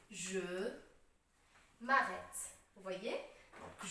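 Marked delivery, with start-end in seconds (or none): 0.1-2.4 measured "je", pause, then "m'arrête" as one word; elided je m'arrête. Shown as a demonstration. Teacher presenting the final sentence, "m'arrête" joined. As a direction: say "m'arrête" with the vowel of "me" dropped. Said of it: "la phrase finale est".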